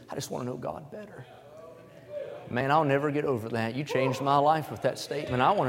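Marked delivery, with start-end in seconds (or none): 0.1-1.2 much softer, barely voiced quote; soft, like a tearful young man; quietly recalling a teary young man's words; hushed retelling. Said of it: "He said".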